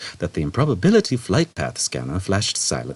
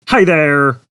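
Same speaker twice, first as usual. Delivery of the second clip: higher, louder, chirpy greeting; bright computer; 9 semitones higher, 7 dB louder than usual. A narrator's reading. Shipboard computer greeting Zaphod, brightly. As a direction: greet like a cheerful salesman. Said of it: "it said brightly".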